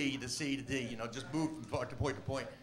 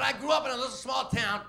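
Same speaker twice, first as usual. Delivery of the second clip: raised, high-pitched stage shout; shouting over chaos; 8 semitones higher, 6 dB louder than usual. Retold or imitated. Comic keeps doing his act under flying beer, hammed-up shouting. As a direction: plough on loudly with the bit regardless.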